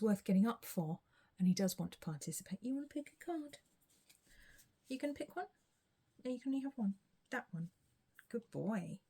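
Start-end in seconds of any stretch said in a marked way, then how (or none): 2.6-9.0 pet talk. Real, not acted. Coaxing the cat to pick.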